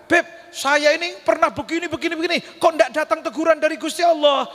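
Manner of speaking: mock a grumbling believer; complaining believer.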